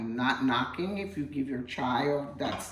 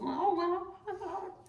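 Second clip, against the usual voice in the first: mock dismay; slower than usual. Real, not acted. After making a mess.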